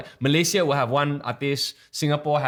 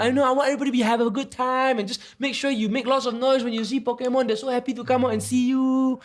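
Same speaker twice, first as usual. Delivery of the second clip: put-on kid MCing voice; 11 semitones higher than usual. Shown as a demonstration. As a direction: talk like a teacher to little kids. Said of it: "like, my kid M C ing voice".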